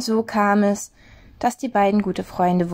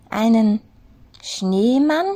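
rising, higher questioning rabbit; bewildered high rabbit echo; puzzled echo; 3 semitones higher, 3 dB louder, slower than usual. A narrator's reading. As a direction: repeat the word as if you've never heard it. Henry echoes puzzled.

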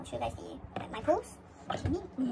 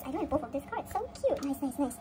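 ordinary talk, nothing marked

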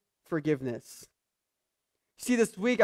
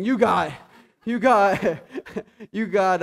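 cracking up, louder, through the words; laughing mid-quote; 4 dB louder, slower than usual. Real, not acted. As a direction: laugh while you try to get it out.

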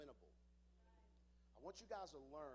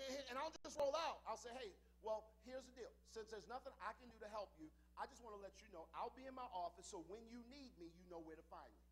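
ordinary delivery

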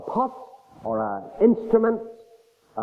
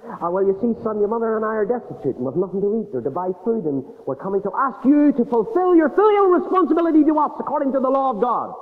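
put-on rising, louder plea of an old father; a father pleading with his son for food; 8 semitones higher, 5 dB louder than usual. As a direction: beg the son for help, building up.

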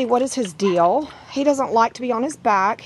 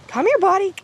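high-pitched call to a pet; coaxing puppy call; 10 semitones higher, 3 dB louder than usual. Real, not acted. Owner calling a puppy over, high coaxing voice. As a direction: lift the voice high to lure the pup.

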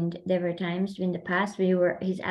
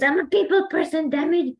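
much higher, louder mock-frustrated cry; upset outburst; 7 semitones higher, 5 dB louder than usual. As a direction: retell his fed-up snap with a grin. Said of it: "And then he gets so upset".

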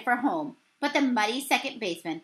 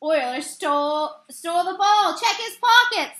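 rising, louder accusing yell; 4 semitones higher, 4 dB louder than usual. A narrator's reading. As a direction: jeer it from the stands. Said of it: "Saint fans yelled".

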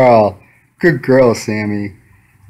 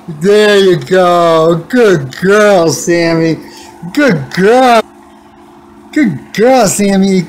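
drawn-out praise; 6 semitones higher, much slower than usual. Real, not acted. Cat finally gives its paw.

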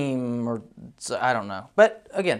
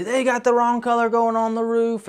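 high, frantic retold complaint; panicked; 8 semitones higher than usual. Homeowner calling the roofer about the wrong color, panicked and high. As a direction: pitch it way up like a client in a panic. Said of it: "she's in a panic".

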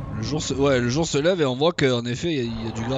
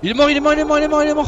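high, loud victory yell; excited shouting; 6 dB louder, faster than usual. Real, not acted. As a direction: shout the kill in triumph. Excited cheer at a kill.

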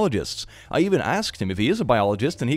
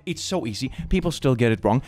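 ordinary talk, nothing marked

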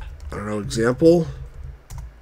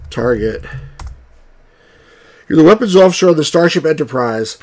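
normal talking voice

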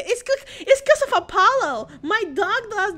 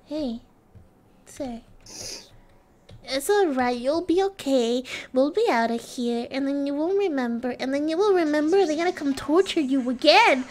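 lower-pitched, consoling tone; mock comforting; 5 semitones lower than usual. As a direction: soothe someone gently, tongue in cheek. Comforting a game character.

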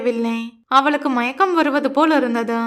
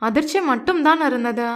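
ordinary delivery, nothing marked

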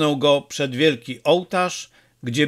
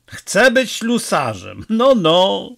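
slow, higher, wondering echo; musing mutter; 5 semitones higher, slower than usual. A narrator's reading. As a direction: mull over the boy's words, lightly marvelling. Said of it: "pomrukiwał Don Camillo". (powoli, z lekkim niedowierzaniem, do siebie)

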